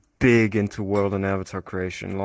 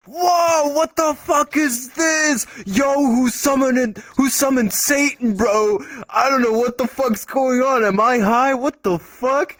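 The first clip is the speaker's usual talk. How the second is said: amazed shouts; 13 semitones higher than usual. Retold or imitated. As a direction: shout it like seeing Satan appear. Crowd reacting to boss avatar.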